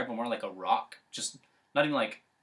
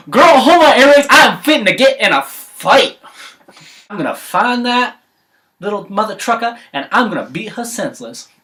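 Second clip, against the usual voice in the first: high, loud sassy threatening; sassy fight threat; 11 semitones higher, 15 dB louder than usual. Acted. Mom bear vowing to beat Goldilocks, loud. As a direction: gear up for a fight.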